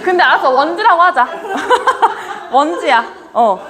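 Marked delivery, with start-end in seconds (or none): 1.3-2.5 short burst of laughter; amused laugh. Real, not acted. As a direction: laugh at the name muddle. Audience banter over character names.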